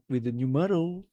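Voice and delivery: ordinary talk, nothing marked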